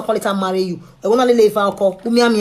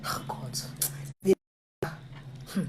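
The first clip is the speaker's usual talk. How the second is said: whisper; 13 dB quieter than usual. Real, not acted. Whispered sigh.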